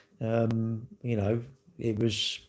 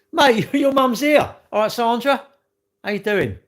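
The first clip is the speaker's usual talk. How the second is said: mock medium greeting; 10 semitones higher, 6 dB louder than usual. Calling out to the mum.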